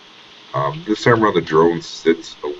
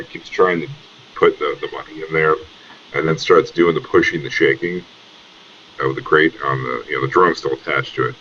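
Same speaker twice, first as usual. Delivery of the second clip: normal talking voice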